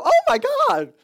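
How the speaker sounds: high, loud mock exclamation; mock excited. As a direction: imitate someone blurting it out in excitement.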